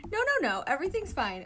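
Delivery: high, loud flustered denial; hasty reassurance. Acted. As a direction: rush to insist it's all fine. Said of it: "Oikawa reassured".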